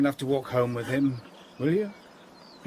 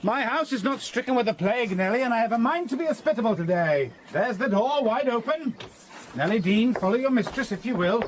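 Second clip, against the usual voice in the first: commanding; 6 semitones higher than usual. Acted. Master commands housekeeper to come in.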